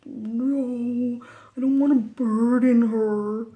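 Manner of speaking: drawn-out, hammed-up protest; mock reluctance. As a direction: exaggerate a reluctant refusal to the therapist. Acts out her reluctant reply.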